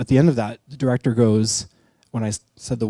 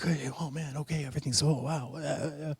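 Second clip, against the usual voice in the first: mock meth addict; 3 semitones higher, 13 dB quieter than usual. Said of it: "they're all really like".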